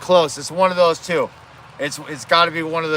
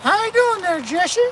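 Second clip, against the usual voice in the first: very high falsetto greeting; put-on character voice. Character greeting Jesse, high falsetto impression. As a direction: greet in a put-on, far-higher voice.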